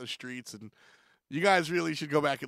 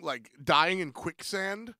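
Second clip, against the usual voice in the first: ordinary talk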